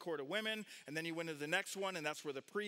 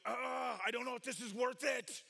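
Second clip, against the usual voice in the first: acted exasperation; 5 semitones higher than usual. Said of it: "believers who are going".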